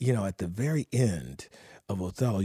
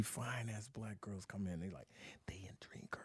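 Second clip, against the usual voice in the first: hushed gossip whisper; quiet, secretive aside; 3 semitones lower, 22 dB quieter than usual. Real, not acted. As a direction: lean in and whisper it. Gossiping about girls entering.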